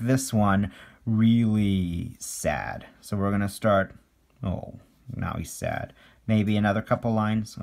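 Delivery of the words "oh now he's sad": low, soft pitying coo; a hammed-up little "oh" of sympathy; mock sad. Cartoonist drawing a sad face for kids, mock sadness.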